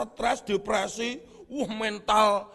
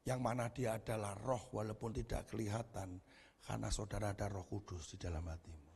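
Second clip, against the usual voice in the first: much softer, intimate preaching; hushed sermon; 12 semitones lower, 12 dB quieter than usual. Acted. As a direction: lower and draw them in gently.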